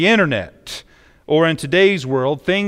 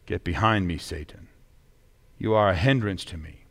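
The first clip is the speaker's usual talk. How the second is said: low, soft, measured reading; quiet rebuke; 7 semitones lower, 6 dB quieter than usual. A narrator's reading. Jesus turning to rebuke Peter, read quietly. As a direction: deliver the rebuke low and hushed, with pauses.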